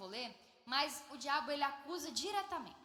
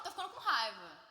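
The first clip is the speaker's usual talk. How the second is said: hammed-up crossness, punched out loud; mock anger; 7 dB louder than usual. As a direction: scold loudly as an acting game.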